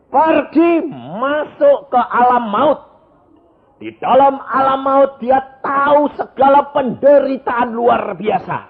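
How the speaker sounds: loud, slow proclaiming with a laugh; full-force preaching. Acted. Pastor to congregation, loud slow preaching. Preacher describes realm of death.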